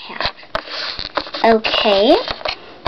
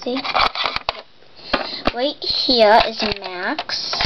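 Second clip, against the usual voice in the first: ordinary talk, nothing marked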